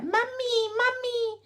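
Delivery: put-on very high, small clingy child calling mummy; imitating a shy toddler clinging to mum. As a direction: whimper for mum like a timid two-year-old. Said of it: "our two-year-olds are just like".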